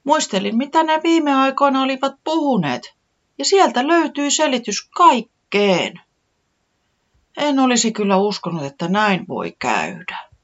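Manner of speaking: high, eager small chick; little Tiputi voice. A narrator's reading. Tiputi recounting what she saw, high and eager.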